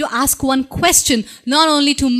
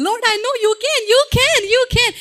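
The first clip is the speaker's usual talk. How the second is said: an eager believer piling on you can; eager excited faith; 10 semitones higher, faster than usual. Retold or imitated. Martha cheering Jesus on, high and eager. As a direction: voice climbing higher and quicker, you can. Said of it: "somebody who has some faith and saying".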